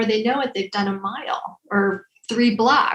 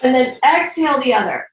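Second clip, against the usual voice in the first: guided breathing instructions; 3 semitones higher than usual. Acted. Leads breathing exercise.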